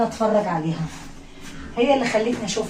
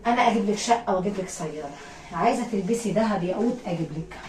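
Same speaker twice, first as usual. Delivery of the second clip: ordinary delivery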